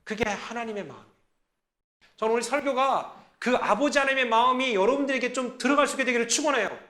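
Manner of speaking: ordinary talk, nothing marked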